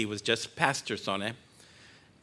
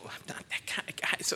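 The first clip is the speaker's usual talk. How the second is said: real emotion, barely voiced whisper; fighting back tears in a hush; choked whisper; 9 dB quieter than usual. Real, not acted. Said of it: "I don't like being emotional".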